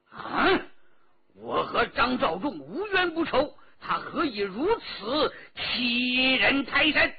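angry outcry. A narrator's reading. Old escort chief, outraged at Zhang's demands, angry shout. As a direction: yell it in outrage at the insult. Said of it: "怒气冲天，叫了起来".

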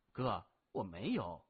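usual speaking voice

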